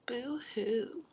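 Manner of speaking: usual speaking voice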